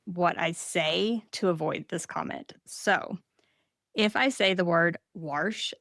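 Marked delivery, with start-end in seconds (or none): 5.2-5.7 "warsh" with an R before the sh; Southern "warsh" pronunciation. Shown as a demonstration. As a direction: put an intrusive R into "warsh". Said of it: "I can't say it without a R".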